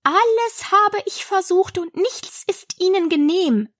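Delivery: high-pitched, tearful lament; mock sobbing. A narrator's reading. Pigeon complains nothing works, half-sobbing. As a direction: wail lightly as a despairing pigeon. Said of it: "fuhr schluchzend fort".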